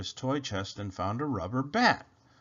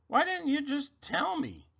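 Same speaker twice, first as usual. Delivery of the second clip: high-pitched, exasperated question; exasperated rabbit; 14 semitones higher than usual. A narrator's reading. Rabbit has found the remote, high exasperated complaint. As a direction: ask it way up high.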